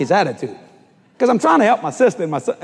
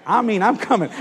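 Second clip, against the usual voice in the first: ordinary talk, nothing marked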